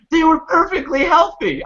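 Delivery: very high-pitched mock protest; retold outrage, voice pitched right up; angry grandma. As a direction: imitate grandma indignantly objecting. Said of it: "my grandma was hot".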